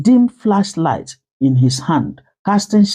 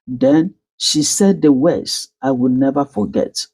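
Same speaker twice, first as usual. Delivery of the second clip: ordinary delivery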